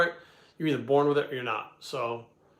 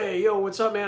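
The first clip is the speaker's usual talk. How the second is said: retold hailing; 7 semitones higher than usual. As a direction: re-enact hailing a buddy from a car. Acted calling out from a car.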